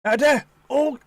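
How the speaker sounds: sudden high loud cry of header; excited call. Real, not acted. Co-commentator calling the header, excited and loud. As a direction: shout it as the ball flies in. Goal chance in the match.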